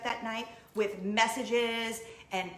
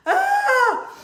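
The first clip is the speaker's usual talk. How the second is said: upset cry; 13 dB louder than usual. Real, not acted. Exclamation of upset.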